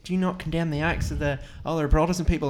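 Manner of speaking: high mimicked counter-accusation; high-pitched mock old-geezer retort. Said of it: "you would swear they were like old geezers".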